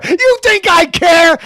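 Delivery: loud, high, slow scoff; a loud, slow, defiant yell; defiant shout. Acted. Defiant outburst at Maher.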